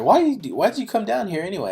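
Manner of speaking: high, quick mock mom voice, faintly scolding; mom's voice. Son retelling his mom questioning him, high mock mom voice. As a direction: pitch it up like a puzzled mother.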